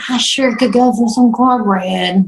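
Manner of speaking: slow, hammed-up craving; hinting request. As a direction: drop a heavy, drawn-out hint. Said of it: "I just kinda request as I rub my belly".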